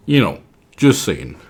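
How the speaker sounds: usual speaking voice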